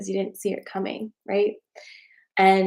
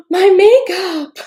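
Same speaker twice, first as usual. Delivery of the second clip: gasp, then high, loud, drawn-out wail; mock distress; 11 semitones higher, 10 dB louder, much slower than usual. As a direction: wail it like a hammed-up tragedy. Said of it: "I'm like".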